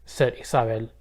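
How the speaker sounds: plain reading voice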